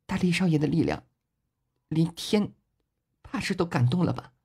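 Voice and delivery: soft, high, moved murmur; hushed, high, deeply touched voice; tearful murmur. A narrator's reading. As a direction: murmur it through tears. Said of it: "边流泪儿边是喃喃说道".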